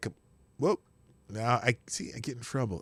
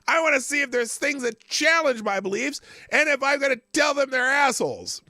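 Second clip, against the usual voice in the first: exasperated quote; 10 semitones higher, 5 dB louder than usual. Retold or imitated. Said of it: "you're like".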